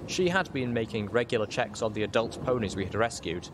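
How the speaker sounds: plain reading voice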